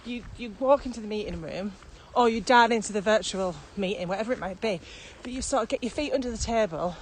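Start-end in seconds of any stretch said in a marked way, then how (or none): none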